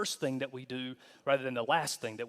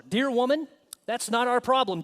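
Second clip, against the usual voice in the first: higher, louder Jesus brushing his mother off; Jesus lightly voiced, raised and pointed; Jesus voiced sharply; 7 semitones higher, 5 dB louder than usual. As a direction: play Jesus as abrupt and firm. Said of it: "Jesus replied".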